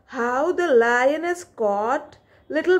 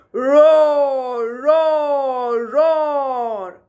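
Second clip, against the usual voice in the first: loud spoken roar fading off; mock lion roar; 4 dB louder, much slower than usual. Lion roaring caught in the net, mock roar fading. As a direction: roar like a lion, then die away.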